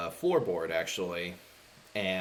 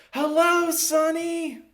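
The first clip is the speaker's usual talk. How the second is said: put-on very high, loud children's chorus; crowd of kids; 7 dB louder, much slower than usual. Kids in the crowd greeting the clown, high drawn-out shout. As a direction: shout it in a squeaky kid voice. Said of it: "you just see a bunch of kids, um, in the, the crowd just going".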